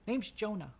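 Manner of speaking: put-on high-pitched little boy voice; high, boyish call to grown-ups; young boy. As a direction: pipe up as a small kid. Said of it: "a young boy with kind of".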